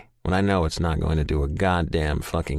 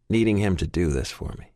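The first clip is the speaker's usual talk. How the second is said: usual speaking voice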